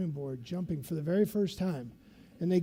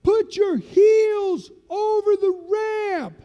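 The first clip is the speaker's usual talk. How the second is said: slow, high, loud shouted command; sergeant's order; 13 semitones higher, 10 dB louder, much slower than usual. Retold or imitated. Master sergeant ordering lieutenant to the ramp, shouted. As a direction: bellow it slowly over the wind.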